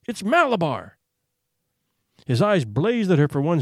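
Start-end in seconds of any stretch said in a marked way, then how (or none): 0.1-1.0 a touch higher, milder repeat of the scream; strange scream. Acted. Boy on the rocking horse repeating the name, milder strange scream. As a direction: hold on to the name as the cry fades. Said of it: "he screamed in a powerful, strange voice".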